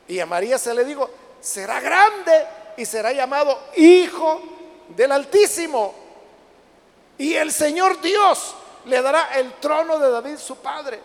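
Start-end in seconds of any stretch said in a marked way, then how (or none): 1.4-11.0 slow, louder preacher's declaration; scripture proclamation. Acted. Angel's promise to Mary, proclaimed slowly and forcefully. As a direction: ring out each title in measured phrases.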